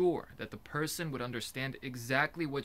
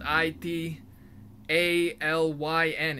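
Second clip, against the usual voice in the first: letters spaced out one by one; spelled out slowly; 4 semitones higher, 8 dB louder, much slower than usual. Shown as a demonstration. Spelling out a mock Italian word, letters slow and louder. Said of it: "it would be spelled".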